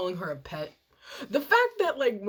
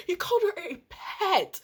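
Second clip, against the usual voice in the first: ordinary talk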